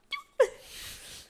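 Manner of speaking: a tiny breathy high sneeze; stifled sneeze. Retold or imitated. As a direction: hold in a sneeze. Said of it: "So I'm like".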